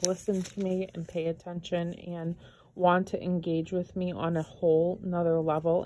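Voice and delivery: ordinary delivery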